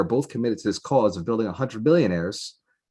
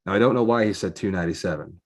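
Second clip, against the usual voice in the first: ordinary talk, nothing marked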